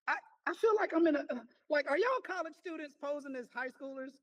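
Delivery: astonished teacher, high and loud; amazed disbelief. Real, not acted. Amazed at students' answers.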